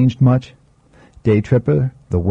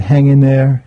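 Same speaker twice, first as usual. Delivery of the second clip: louder; 5 semitones higher, 5 dB louder than usual. Acted. Parting words quoted.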